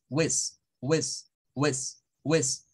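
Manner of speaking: "whiz" with a short, quick I; quick short vowel. Shown as a demonstration. Teacher drills the syllable, short and quick. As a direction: say each "whiz" briefly, on its own. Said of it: "esta frase se dice rápido".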